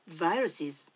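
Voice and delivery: viruses with a clear English V and plural ending; English pronunciation. Shown as a demonstration. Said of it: "ウイルスを英語で言う時は". (英語の発音でviruses)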